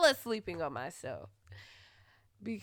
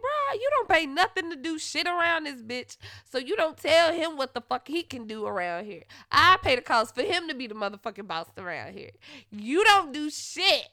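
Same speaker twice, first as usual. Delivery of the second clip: loud, higher ranting yell; angry tirade; 5 semitones higher, 8 dB louder than usual. Retold or imitated. Ranting at freeloading boyfriend, loudly furious. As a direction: go off on him full force.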